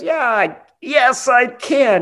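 high, slow hammed-up whimper; mock strained reply. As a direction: drag it out high like a beaten man insisting. Mimics battered Fletcher replying.

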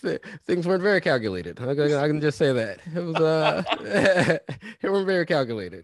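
laughing through it. Real, not acted. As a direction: admit it with laughs. Laughing admission about friend's lapses.

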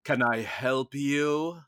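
slow, put-on German accent, hammed up; a clumsy imitation of a German accent. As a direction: play the stern German manager with a thick German accent. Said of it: "in this deep German accent".

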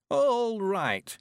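put-on high old man voice, slightly nervous; steady himself, a touch anxious; nervous old suitor; a little anxious. A narrator's reading. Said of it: "just a little anxiously".

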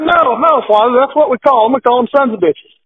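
put-on high, earnest kid's voice insisting; boy's voice. Young boy tells the priest that's what they call the fish, high and earnest. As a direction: play the innocent boy setting the priest straight. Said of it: "He said".

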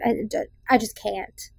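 normal talking voice

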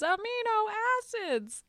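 high, sing-song group answer; chorus reply. Retold or imitated. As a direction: chime it in high like the whole table at once. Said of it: "everyone together says".

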